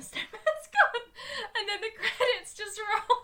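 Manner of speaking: high, breaking up with laughter; real helpless laugh; laughing while talking. Real, not acted. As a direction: barely get it out through laughter. Cracking up at the sad ending.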